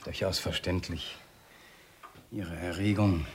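soft, low and controlled; quietly regained control; subdued composure. Acted. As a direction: drop to a hushed, measured calm. Calming down after the shouting.

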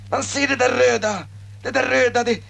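ordinary talk, nothing marked

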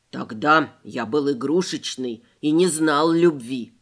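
low, solemn rabbit voice; mock-dramatic grand declaration; патетично. A narrator's reading. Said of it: "патетично заявил ушастик". (пафосно, с надрывом)

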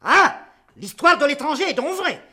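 loud sudden alarm; alarmed outburst. Acted. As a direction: burst out, suspicious. Father bursts out alarmed.

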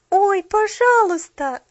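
high, loud slow plea; pleading. A narrator's reading. Girl begging the shopgirl, pleading. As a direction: beg her. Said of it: "взмолилась Полианна".

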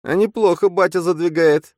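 a shrill impressed inner voice; impressed double. A narrator's reading. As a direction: marvel with a whistle. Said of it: "присвистнул Фантом".

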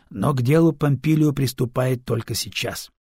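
plain reading voice

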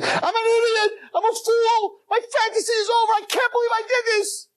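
high crying wail of regret; despairing self-blame, sobbed out; screaming in despair. Acted. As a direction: scream at yourself through tears. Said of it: "and screaming".

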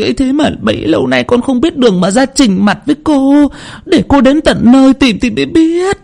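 very high, overwhelmed stammer; flustered stammering. A narrator's reading. Woman realises the spirit's identity, stammering in a high voice. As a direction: stumble over repeated words in startled recognition. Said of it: "liền lắp bắp".

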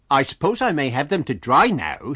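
firm and loud, brooking no denial; insist, leave no room to refuse. A narrator's reading. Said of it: "in a voice that brooked no denial".

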